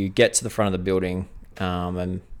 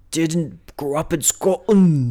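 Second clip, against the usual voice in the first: put-on Scottish accent; 5 semitones higher, slower than usual. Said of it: "you gotta work on your voices".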